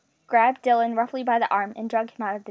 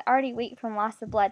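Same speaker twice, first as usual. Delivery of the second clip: ordinary delivery, nothing marked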